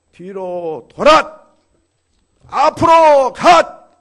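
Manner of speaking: very loud, drawn-out military order; slow parade-ground shout; drill command. Acted. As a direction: bellow it like a drill sergeant.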